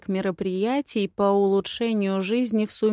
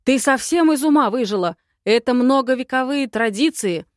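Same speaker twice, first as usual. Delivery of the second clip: higher and louder, shouting her down; furious shout; 5 semitones higher, 6 dB louder than usual. A narrator's reading. Old adviser shouts at the girl over tradition, furious. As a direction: yell it as outraged tradition.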